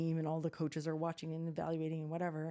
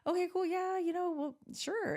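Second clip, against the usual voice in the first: high, pitched-up polite acceptance; awkward agreeing; 13 semitones higher than usual. Retold or imitated. Mother accepting coaches' unexpected offer, high awkward agreement. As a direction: retell going along with it, voice raised high. Mother covering surprise on call.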